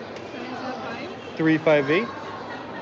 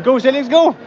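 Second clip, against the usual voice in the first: high, loud go calls; excited cheering; 7 semitones higher, 3 dB louder than usual. Real, not acted. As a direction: cheer Célix on. Cheering a player.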